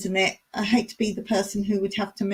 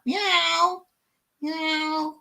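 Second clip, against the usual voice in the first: high drawn-out meows; playful high cat imitation; 6 semitones higher than usual. Shown as a demonstration. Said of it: "Did we do a cat?".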